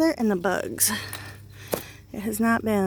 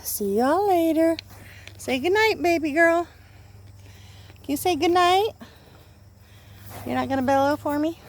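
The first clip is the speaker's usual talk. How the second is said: sleepy talk to pet; 7 semitones higher than usual. Real, not acted. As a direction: coax the animal to say goodnight. Goodbye to cow and viewers.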